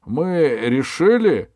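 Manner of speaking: громко, высоко, с растяжкой; провозглашай медленно и торжественно; королевское заявление. A narrator's reading. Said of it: "продолжал король". (торжественное провозглашение)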